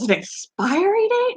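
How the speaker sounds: high questioning expiry date; husband echoing in disbelief, high; incredulous husband. Retold or imitated. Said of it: "He goes".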